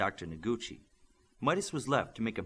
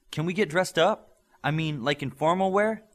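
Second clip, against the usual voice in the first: higher, louder, grinning question; bubbling over at the idea of dressing up; smiling, excited plea to the coach; eager with a smile; 7 semitones higher, 7 dB louder than usual. A narrator's reading. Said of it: "Virtuoso said with a smile".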